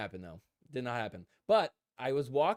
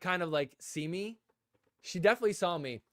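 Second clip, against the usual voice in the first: ordinary talk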